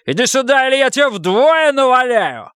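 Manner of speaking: high, raging shout; furious threat. A narrator's reading. Husband ordering wife over under threat, enraged. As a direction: yell it, threatening her.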